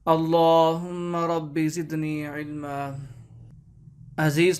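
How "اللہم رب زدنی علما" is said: slow, reverent recitation of a dua; slow devotional chant; slow prayer recitation. Acted. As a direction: intone the opening prayer slowly.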